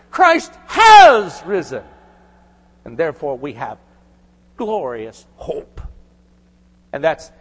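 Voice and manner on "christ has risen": "Christ has risen" shouted out, higher, slow and loud; proclaimed. Shown as a demonstration. Preacher proclaiming the risen Christ to the congregation, shouting. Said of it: "I would have emphasized this word".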